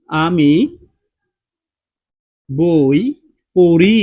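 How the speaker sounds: slow, loud, word by word, landing on পড়ি; slow example sentence. Shown as a demonstration. Teacher spells it out for the class, slow and loud. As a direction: spell out আমি বই পড়ি slowly and loudly, one word at a time, rising on পড়ি. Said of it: "উদাহরণ দিয়ে তোমাকে বুঝাই দিই".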